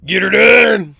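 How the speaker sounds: high, loud Southern shout; full-throated Southern whoop; Southern yell. Shown as a demonstration. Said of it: "I shouldn't do that on my voice".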